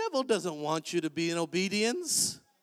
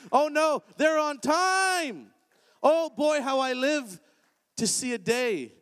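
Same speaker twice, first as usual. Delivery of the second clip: louder, higher rousing preacher voice building to a peak; preaching at full force; 4 semitones higher, 7 dB louder than usual. Acted. Pastor firing up the congregation, loud rousing preaching. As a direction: whip the church up, climbing with each line.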